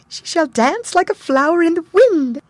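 very high, loud airy fairy. A narrator's reading. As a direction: float it up high and light. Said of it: "The fifth said".

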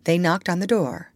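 plain reading voice

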